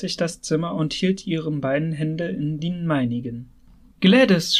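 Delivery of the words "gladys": an urgent call of her name, louder and higher; a cry of greeting; shouting her name. A narrator's reading. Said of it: "schrie ich".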